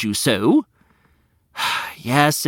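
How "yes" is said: usual speaking voice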